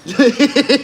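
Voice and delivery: loud high laugh; real, high-pitched cackle of amusement. Real, not acted. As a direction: burst out laughing. Laughs at the tip story.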